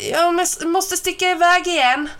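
high, loud call back. A narrator's reading. Ben calls up to his mother while rushing, loud. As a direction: shout it up the stairs in a hurry. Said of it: "ropade Ben".

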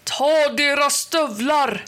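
high roared command. A narrator's reading. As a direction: roar the order to the guards. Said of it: "vrålade".

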